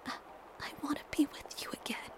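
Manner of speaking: shaky, barely voiced whisper; trembling whisper. A narrator's reading. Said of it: "I confess in a trembling whisper".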